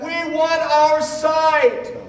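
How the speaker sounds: very high, very slow, drawn-out cry; shouted back. Acted. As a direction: stretch the demand out across the crowd. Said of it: "they shouted back".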